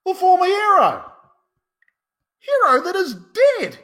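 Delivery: high, loud exclamation of shock; astonished cry. Acted. Groom seeing his dead bride alive, astonished cry. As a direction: cry out in amazement, pitch leaping up.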